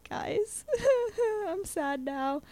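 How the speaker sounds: high-pitched playful whimper; hammed-up hurt, half laughing; mock dismay. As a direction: plead in a small, high mock-sad voice. Acting out own dismay to classmates.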